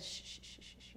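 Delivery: soft unvoiced shushing; soothing shush. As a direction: hush her gently like a comforting parent. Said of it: "I felt the Lord just speaking to me".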